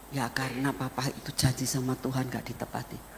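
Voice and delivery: quick, soft, low admission; subdued confession. Retold or imitated. Father admitting his broken promise to the children, subdued. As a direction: admit it quietly, head down.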